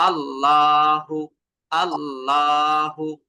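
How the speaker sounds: আল্লাহু drawn out very slowly with a heavy lam; heavy lam recitation. Shown as a demonstration. Teacher recites আল্লাহু to show the heavy lam. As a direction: stretch আল্লাহু with a full, thick lam. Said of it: "কিভাবে? যেমন".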